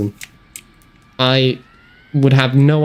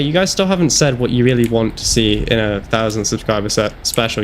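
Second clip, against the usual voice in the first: normal talking voice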